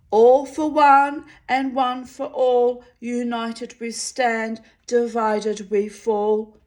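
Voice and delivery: high, loud shouted slogan; shouted motto. Acted. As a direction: bellow the motto at the trees. Giant shouts whole motto.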